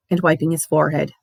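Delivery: usual speaking voice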